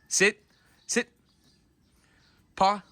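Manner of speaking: stern repeated sit commands; firm dog command. Real, not acted. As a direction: make the dog obey.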